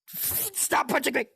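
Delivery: high, playful complaint; mock whiny plea. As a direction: pretend to be hurt by the punches.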